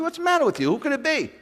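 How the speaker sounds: very high, squeaky mock voice; mock the devil's questioning; mock whiny Satan.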